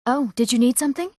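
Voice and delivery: ordinary talk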